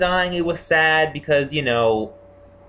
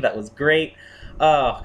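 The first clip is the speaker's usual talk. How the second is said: usual speaking voice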